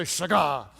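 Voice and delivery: shagah with some punch; with attitude. Shown as a demonstration. Preacher has congregation repeat a Hebrew word, with attitude. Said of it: "say it like that with attitude".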